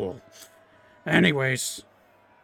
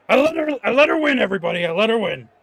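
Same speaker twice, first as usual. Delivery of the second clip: high, loud, indignant excuse-making; a hammed-up sore loser; 8 semitones higher, 6 dB louder, faster than usual. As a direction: whine to the crowd that he let her win. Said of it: "and he's like".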